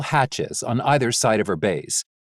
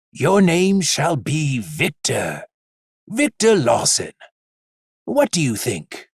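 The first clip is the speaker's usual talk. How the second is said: tender father; 3 semitones higher than usual. Acted. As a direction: speak it like a proud new father. Father names son, tender.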